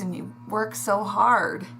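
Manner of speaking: usual speaking voice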